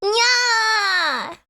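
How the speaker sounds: high, drawn-out nya; aggressive cat cry. Pretend. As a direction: yowl an over-forceful anime meow. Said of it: "Why is it so aggressive?".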